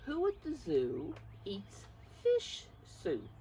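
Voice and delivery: usual speaking voice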